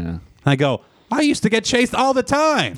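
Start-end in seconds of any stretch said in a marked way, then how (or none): 1.1-2.7 high-pitched incredulous protest; aggrieved high-pitched exclamation; indignant outburst. Retold or imitated. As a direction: squawk it in mock outrage, pitch way up. Mock outrage at news of police.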